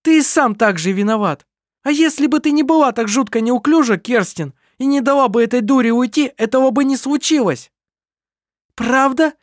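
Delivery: heated quarrel. A narrator's reading. Heated quarrel among siblings.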